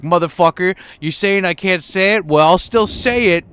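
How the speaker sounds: hammed-up angry yell; mock angry rant. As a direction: rant like an offended comedian.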